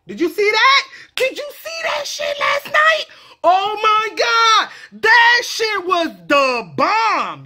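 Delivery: high-pitched, stretched-out gushing; excited raving. Acted. Friend raving about last night, excited high voice. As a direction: rave high, then draw the words out slowly.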